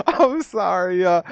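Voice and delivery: laughing through I'm sorry; laughing apology. Real, not acted. Reacting to the Israelites clip, laughing helplessly. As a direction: crack up mid-word, a bit slow.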